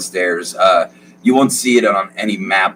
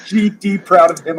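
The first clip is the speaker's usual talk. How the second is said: high gushing father; beaming father voice, much higher; proud dad; 10 semitones higher than usual. Acted. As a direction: brag about your boy. GM plays the father.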